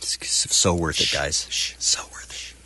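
hushed whisper, barely voiced. Real, not acted. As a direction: whisper so the call can't hear. Whispering during live call.

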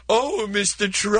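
high, slow, simpering awe; fawning Piers Morgan. Retold or imitated. Piers Morgan gushing over Trump, simpering mock voice. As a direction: swoon over the big boss. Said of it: "he's like".

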